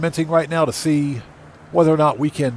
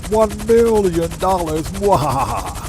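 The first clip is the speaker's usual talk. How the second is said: slow, higher evil villain voice; Doctor Evil voice; 4 semitones higher, slower than usual. Retold or imitated. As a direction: gloat like a cartoon supervillain. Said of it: "in our Doctor Evil voice".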